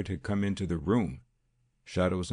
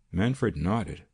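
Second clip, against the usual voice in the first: ordinary delivery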